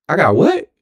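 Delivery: high shocked question; can't believe what he hears; disbelieving what. Retold or imitated. Told an ambulance is waiting, high disbelief. Told he's going to hospital.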